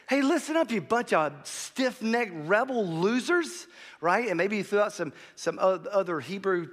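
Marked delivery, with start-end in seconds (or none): 0.1-3.7 high, loud mock scolding; mad tirade. Preacher acting Moses berating the people, angry tirade. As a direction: lay into them, hammering out each insult. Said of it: "he was just mad".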